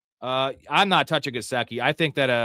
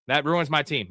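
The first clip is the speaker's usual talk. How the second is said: normal talking voice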